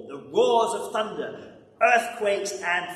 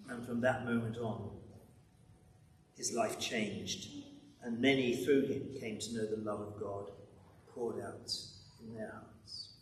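reverent hush; 7 semitones lower, 9 dB quieter than usual. Acted. God's love poured out, reverent.

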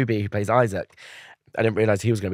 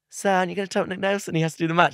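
higher, quick eager student; audition take; 8 semitones higher, faster than usual. Retold or imitated. Actor recalling his Zoom audition, quick eager student line. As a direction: rattle the line off nervously. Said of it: "three, two, one, go".

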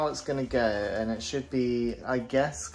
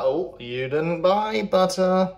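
loud, high, drawn-out whine; slow, exaggerated mock complaint; mocking commenters; 6 semitones higher, 9 dB louder, much slower than usual. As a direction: imitate a nagging online commenter. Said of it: "you in the comments would be like".

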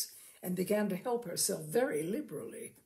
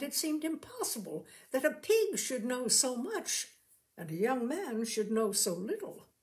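ordinary delivery, nothing marked

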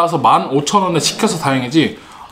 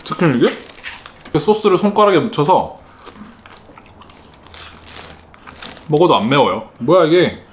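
talking while chewing; finger in mouth. Real, not acted. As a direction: mouth partly full. Seen 1: sauce-covered finger in mouth, then chewing chicken while talking.

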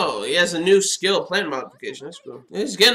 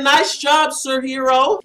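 higher-pitched, loud congratulations; cheering; 8 semitones higher, 4 dB louder, slower than usual. Real, not acted. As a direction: cheer on your favourite hero. Cheering on the show's hero.

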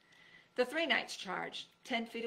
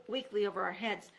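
usual speaking voice

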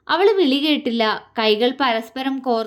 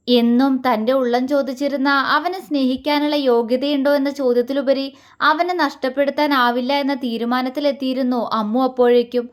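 usual speaking voice

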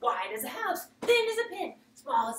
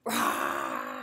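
playful roar. Shown as a demonstration. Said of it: "Can you roar like this boy?".

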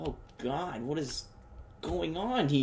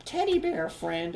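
very high falsetto mom impression; put-on high-pitched mommy voice, dropping at the end; mock mom voice. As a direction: squeak it out like a hammed-up mother. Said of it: "My mom be washing babies in there".

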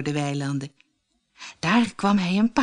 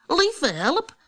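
much higher, startled little voice; a tiny cookie in shock; alarmed gingerbread man; 9 semitones higher than usual. A narrator's reading. The gingerbread man to the fox, high and dismayed. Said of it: "zei de gemberkoekman".

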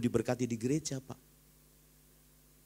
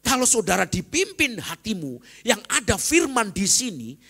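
loud, high preaching climax; fiery sermon peak; preaching at full force; 10 semitones higher, 9 dB louder than usual. Acted. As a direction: proclaim it to the whole church. Preacher raises voice.

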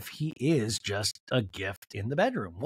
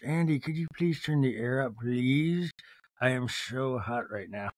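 drawn-out, put-on plea; lightly mocking, lower-voiced complaint; Meredith Marks; 4 semitones lower, 4 dB quieter, slower than usual. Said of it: "I'm the opposite of Meredith Marks".

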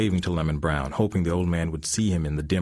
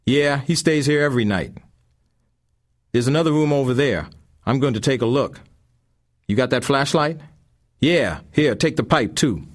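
high and loud tough voice; menacing intruder; 8 semitones higher, 7 dB louder than usual. A narrator's reading. Thugs searching the dark house, loud and high. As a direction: bark it like a thug on the hunt.

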